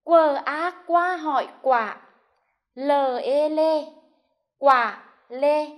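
slow syllable-by-syllable spelling, quờ A qua hỏi quả; teacher spelling quả lê aloud. Shown as a demonstration. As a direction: spell it out slowly for the class to follow. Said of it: "đánh vần theo cô".

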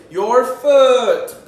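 loud, high, stretched-out call; big sing-out reveal; triumphant answer reveal. Shown as a demonstration. Calling out the blended word.

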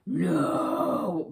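a hint of a universal threatening growl; mild mock growl of warning; deep growling no. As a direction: lightly imitate a deep, menacing growl. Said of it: "threatening, deep growling".

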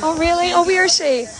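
excited. Real, not acted. Streamer hears singer's mum is here.

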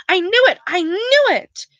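high, slowing triumphant cry. A narrator's reading. Hermione to the boys after finding Flamel, triumphant. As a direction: crow over the find.

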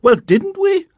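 very high, loud demanding shout; angry shout. A narrator's reading. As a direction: demand an answer, furious. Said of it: "she shouted".

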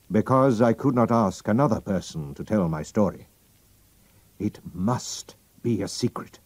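a hint of a proud royal, pressing; drawing himself up, then urging secrecy; lightly voiced king. The king reveals his name to Holmes, lightly voiced.

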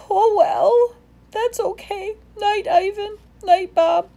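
put-on high, resigned small child voice; sad little elephant. A narrator's reading. Ruby saying goodnight, high and resigned.

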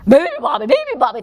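loud, high booming chant; thundering. Acted. Moose and buffalo waking the bird, thundering. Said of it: "thundered the moose and the water buffalo".

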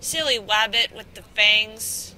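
drawn-out Elmer Fudd style tease; mock cartoon voice. Teasing the fanged creature in a cartoon voice.

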